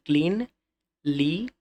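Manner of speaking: "Cleanliness" in three separate beats, clean, লি, নেস; teacher splitting "cleanliness" into its three syllables. Shown as a demonstration. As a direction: count out "cleanliness" with a clear pause between each syllable. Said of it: "তিনবারে উচ্চারণ হচ্ছে".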